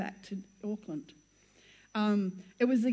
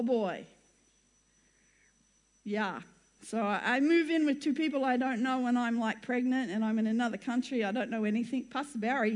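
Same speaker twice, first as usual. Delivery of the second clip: ordinary delivery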